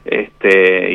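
ordinary talk

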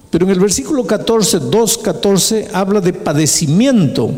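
ordinary delivery

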